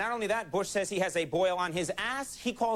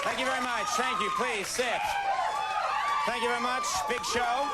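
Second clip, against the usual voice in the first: calling over applause; 10 semitones higher, faster than usual. Real, not acted. Talk show host opening over applause.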